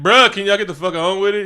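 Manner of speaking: loud, high exasperated heckle; comic impatient outburst; impatient M'Baku. Acted.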